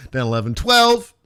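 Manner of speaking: usual speaking voice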